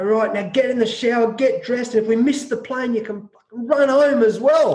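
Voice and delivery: a retold coach laying down the law; stern coach orders. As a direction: bark orders at the hungover players. Coach orders hungover players.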